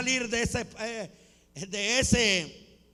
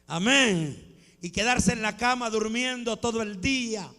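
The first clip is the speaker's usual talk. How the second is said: ordinary talk, nothing marked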